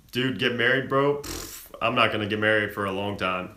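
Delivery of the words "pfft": soft puff of scoffing air; dismissive pfft. Real, not acted. Streamer told to get married, dismissive pfft. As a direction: blow off the idea with a pfft.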